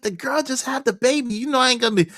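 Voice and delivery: very high-pitched pleading defense; incredulous protest. Retold or imitated. Husband telling wife the girl just had the baby, high protest. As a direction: plead your case way up high.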